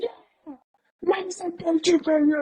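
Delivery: very high-pitched mock scream; imitated rapper's scream. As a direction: mimic a rapper wailing at the top of his lungs, without full volume. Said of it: "he'll be screaming at the top of his lungs".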